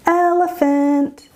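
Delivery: "Elephant" high, slow and sung; pause, then lift "Elephant" out in a high, held tone; melodic, stretched "Elephant"; acoustic highlighting. Shown as a demonstration. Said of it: "I need to focus on what's coming next".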